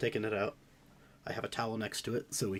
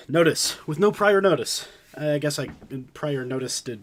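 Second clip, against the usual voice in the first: higher, louder, strained from cold; ice bath breathing; 6 semitones higher, 12 dB louder than usual. Real, not acted. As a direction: push through the freezing cold.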